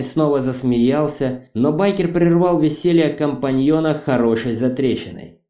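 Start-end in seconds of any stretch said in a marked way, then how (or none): none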